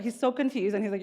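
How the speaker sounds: normal talking voice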